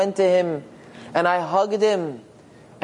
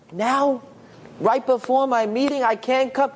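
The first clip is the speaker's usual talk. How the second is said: pitched up in disbelief; a startled, pitched-up "now?!" against the news; shocked protest; 6 semitones higher than usual. Acted. Son balks at urgent call.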